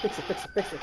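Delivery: low, voiceless frantic repeats; urgent whisper. Real, not acted. Player rushing to fix the system, urgent whisper.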